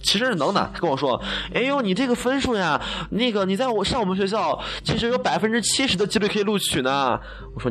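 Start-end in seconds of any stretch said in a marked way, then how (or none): 1.5-7.2 high, sing-song sales pitch; gushing admissions staffer. Retold or imitated. University staffer flattering a prospective student, high sing-song voice. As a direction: sweet-talk the applicant into enrolling.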